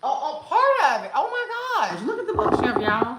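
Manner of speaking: high, loud, laughing exclamation; delighted surprise. Real, not acted. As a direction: squeal through a laugh as it lights up. Bookshelf lights partly come on.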